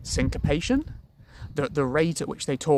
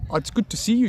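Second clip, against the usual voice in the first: higher-pitched attempt at South African; South African accent; 8 semitones higher, 3 dB louder, faster than usual. Shown as a demonstration. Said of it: "I recently made some South African friends".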